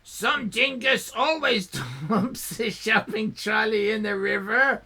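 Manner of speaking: smug croc claiming the trolley; raspy crocodile. Acted. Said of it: "still raspy".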